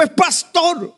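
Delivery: put-on very high child's voice; proud little girl. Pastor voicing his daughter insisting to the teacher, very high child voice. Daughter repeats her answer.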